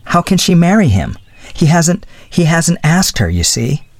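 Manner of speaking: lightly voiced young woman making excuses; flustered young woman. A narrator's reading. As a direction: lift the voice for a flustered girl.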